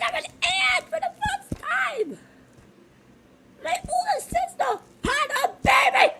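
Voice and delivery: very high-pitched, gushing squeal; ecstatic young aunt. Acted. Aunt meeting her sister's first baby, ecstatic squealing. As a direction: squeal every line as if overcome with joy.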